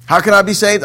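ordinary talk, nothing marked

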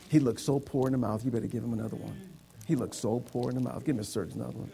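low, quiet acted pity; dutiful pity. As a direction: speak softly, as if obliged to give. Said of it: "or of necessity".

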